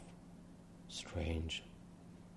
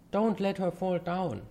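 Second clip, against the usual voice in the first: raised, higher warning call; 9 semitones higher, 4 dB louder than usual. Acted. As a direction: call out anxiously after her. Calling after girl in water.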